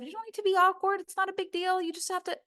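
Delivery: very high, sing-along mimicry of reassurance; mock bad advice. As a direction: parody someone waving the worry away. Mimics bad advice she rejects.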